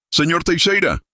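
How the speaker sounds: very high-pitched alarmed call; terrified cry. A narrator's reading. Marcones warning his boss of the creature, terrified cry. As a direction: cry out the name in terror, pitch leaping up. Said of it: "gritó Marcones, aterrorizado".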